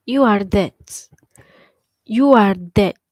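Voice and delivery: loud, blunt shout; angry yell. Real, not acted. Ranting at old men on camera, loud angry shout. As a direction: bark it at them, twice over.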